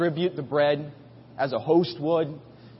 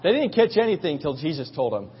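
ordinary talk, nothing marked

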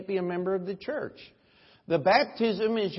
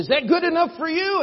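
high, loud, fast Southern pastor; Deep South accent; 11 semitones higher, 8 dB louder, faster than usual. Retold or imitated. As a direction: fire it off in a challenging Southern accent. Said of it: "somebody from way down south".

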